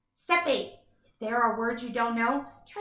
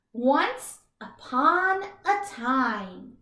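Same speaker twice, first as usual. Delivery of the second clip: drawn-out once upon a time; slow fairy-tale opener; slow storybook opening; much slower than usual. Shown as a demonstration. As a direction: savor each word slowly. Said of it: "using that story in a loud, clear voice".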